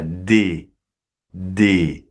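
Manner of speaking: "des" with a closed e, no final s; French "des" sound. Shown as a demonstration. Teacher to learner, modelling how to say "des". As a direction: say "des" cleanly, s silent. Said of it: "qui se prononce comme ça".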